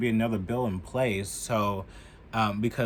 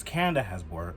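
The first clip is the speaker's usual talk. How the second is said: ordinary talk, nothing marked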